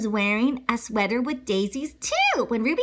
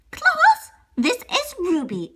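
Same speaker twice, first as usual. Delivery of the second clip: teacher voice; 11 semitones higher, 4 dB louder, slower than usual. Acted. Teacher voice in read-aloud story.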